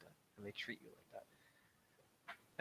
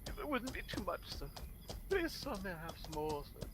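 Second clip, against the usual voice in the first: high, pleading, begging for more; groveling orphan; 7 semitones higher, 4 dB louder than usual. Retold or imitated. Guest groveling to the host for more food, high and pleading. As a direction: beg like a starving orphan, sir.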